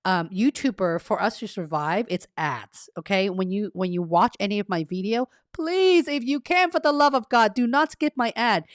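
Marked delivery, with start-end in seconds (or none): 5.5-8.6 high-pitched begging, easing down as it goes; playful pleading. Real, not acted. Streamer begs viewers not to skip ads, pleading. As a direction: implore them, starting high on the please.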